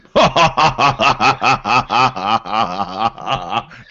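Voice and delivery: long, loud, rhythmic character cackle; mock laughing fit. [name] reacts to the watcher's fish parable, mock laughing fit. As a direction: keep the rapid ha-ha-ha rolling on. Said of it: "[name] starts going".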